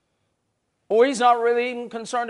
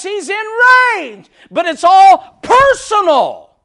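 high, loud, drawn-out shout; slow preaching shout; 9 semitones higher, 12 dB louder, much slower than usual. Real, not acted. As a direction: hammer the point home slowly.

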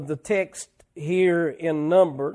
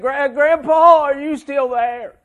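retold child's scared quaver, higher, louder and slow; quivering voice; 8 semitones higher, 7 dB louder than usual. Boy alone in the dark basement calls grandpa, trembling. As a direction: stammer it like a frightened little boy in the dark. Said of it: "I could hear a quiver in his voice".

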